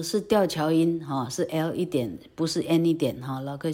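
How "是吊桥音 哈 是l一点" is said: usual speaking voice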